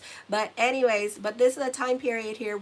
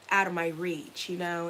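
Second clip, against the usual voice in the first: ordinary talk, nothing marked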